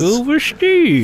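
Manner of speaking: high, drawn-out cartoon voice; Scuba Steve. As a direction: mimic a goofy high character voice. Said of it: "I do, uh, voices".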